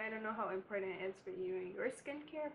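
ordinary talk

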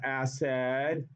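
"acid" drawn out with an "-ed" ending like "said"; acid rhymed with red. Shown as a demonstration. Teacher showing students the rhyme trick, "acid" said as ass-ed. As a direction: say "acid" as ASS-ED, both syllables long. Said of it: "if you say it like that".

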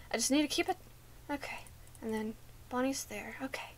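hushed whisper so Bonnie can't hear. Real, not acted. Player hiding from Bonnie, whispering. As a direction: breathe it out in a whisper.

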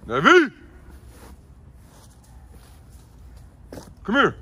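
loud, high call across the yard. Real, not acted. Owner calling dog Navy from across yard, loud high shout.

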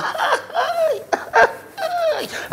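shrill mocking cackle imitating her laugh; mock high cackle. Husband mocks his wife's old café laugh, high cackle. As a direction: cackle like a giddy girl.

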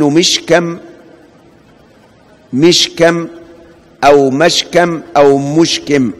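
مشكم with the M voiced as mushkam, then mishkam, then mushkum; مشكم said carefully three ways, changing the first vowel; three vowel readings. Shown as a demonstration. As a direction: say مشكم slowly, once in each of its three vowelings. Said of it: "الميم فيها ثلاث أوجه".

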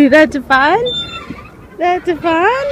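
high, loud, excited questions. Real, not acted. Mom to toddler on the swing, delighted and high.

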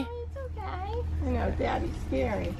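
high, soft comforting coo; calm a scared cat; soothing pet. Real, not acted.

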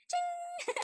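high bright ching like a chime; playful chime noise; star sound effect. Pretend. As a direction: ring it out like a magic star. Teacher makes star sound effect.

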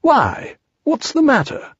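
high questioning bear voice; high, puzzled little bear; concerned Pooh. A narrator's reading. Pooh asks gloomy Eeyore.

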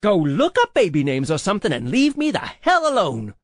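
heated, forceful telling-off; angry yelling. A narrator's reading. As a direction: let it all out in a furious rant. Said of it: "standing up angrily".

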